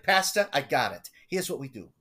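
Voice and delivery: put-on eager old schemer with a thick Long Island accent; Gordon's Long Island accent. Elderly parishioner pitching his pastor a scheme, Long Island accent. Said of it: "and this is how he talks".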